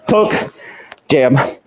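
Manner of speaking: ordinary delivery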